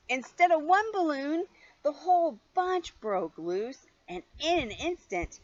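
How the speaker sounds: high, loud dramatic build; excited storytelling. A narrator's reading. Reading to a child, high excited climax. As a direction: make the climax thrilling.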